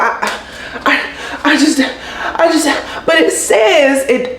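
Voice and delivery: imitating a flustered, halting protest; high, slow stammer, stuck on the words; mock a clerk fumbling for an answer.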